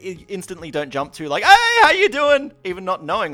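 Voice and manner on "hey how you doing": big, high, drawn-out hello; instant hearty greeting. Acted. Greeting someone he doesn't recognise, hearty and loud. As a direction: jump straight in as if you know them. Models jumping straight into greeting.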